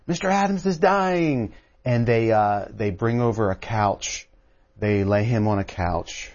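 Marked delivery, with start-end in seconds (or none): 0.1-1.5 much higher, mock alarmed outcry; alarmed cry. Onlooker to the room as Adams falls, retold alarmed cry. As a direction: exclaim it like a shocked onlooker, retold without shouting. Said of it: "there's a gasp in the room".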